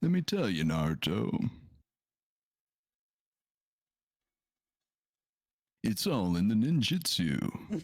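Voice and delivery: calm wise martial arts master; slow, wise sensei voice, lightly done; Guy Sensei impression. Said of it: "get my Guy Sensei impression down".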